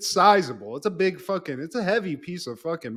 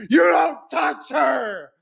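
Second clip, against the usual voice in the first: mock heroic shout; 8 semitones higher, 4 dB louder, slower than usual. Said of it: "Jack's like".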